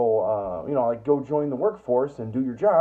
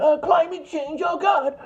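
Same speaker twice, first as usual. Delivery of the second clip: much higher, mocking the climate alarm crowd; mocking whine; 14 semitones higher, 3 dB louder, slower than usual. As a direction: whine it like a panicky believer. Mocking climate change worriers.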